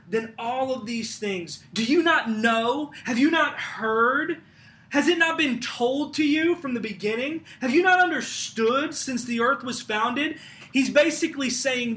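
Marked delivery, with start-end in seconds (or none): 1.7-10.4 raised, higher preaching voice; forceful scripture reading. Acted. Preacher challenging listeners on what they have heard, forceful. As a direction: press each rhetorical question loudly.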